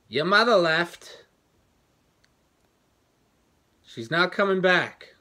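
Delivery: father's news called out slow, high and very loud; loud retold announcement. Father tells son in the doorway, shouted slowly.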